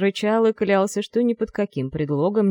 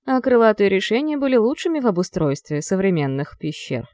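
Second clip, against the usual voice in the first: usual speaking voice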